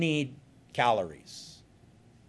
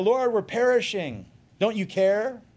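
panicked cry; 9 semitones higher, 5 dB louder than usual. Acted. Disciples crying out in storm.

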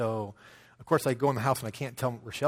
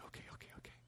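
whispered, bottling up excitement; excited whisper; 22 dB quieter, much faster than usual. Retold or imitated. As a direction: whisper it like a kid trying not to burst. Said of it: "she's trying not to jump around".

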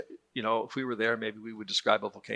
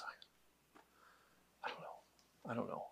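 stumped whisper; 22 dB quieter, slower than usual. Acted. Adam stumped naming the cat.

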